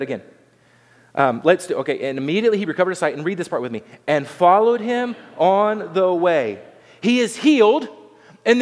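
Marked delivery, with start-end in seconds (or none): 2.1-3.1 very fast run through the verse; quick recap reading, no pauses; hurried re-read. Real, not acted. Hurried re-read to reach congregation's part. 4.1-6.6 slow, evenly spaced words for reading along; drawn-out, deliberate verse reading; leading unison reading. Real, not acted. Leads congregation reading in unison.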